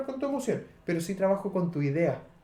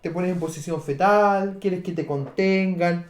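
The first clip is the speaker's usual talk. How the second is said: ordinary talk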